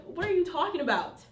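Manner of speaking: acted irritated disbelief; a little mad. Heavenly Father getting a little mad with Moses, mildly exasperated. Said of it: "kinda gets a little mad with him".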